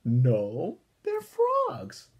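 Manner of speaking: put-on high, loud kid's correction; Buster's voice. Buster correcting Brain about the frogs, high and loud. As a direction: set him straight, high and loud. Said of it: "said Buster".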